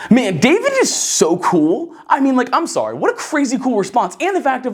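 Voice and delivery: high excited praise of David; excited admiration. Real, not acted. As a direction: gush about how cool he is. Preacher marvels at David's answer.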